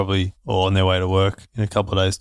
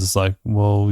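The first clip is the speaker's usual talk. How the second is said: normal talking voice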